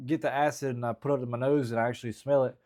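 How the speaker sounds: usual speaking voice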